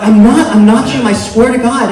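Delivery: loud, high, pleading protest; desperate denial. Acted. Mistaken man swears to the giant guy, desperate denial. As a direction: protest it urgently, voice pushed up.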